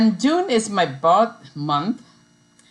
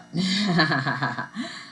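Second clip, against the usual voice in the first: amused laugh; 4 dB quieter than usual. Real, not acted. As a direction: laugh at your own remark. Laughs at own remark.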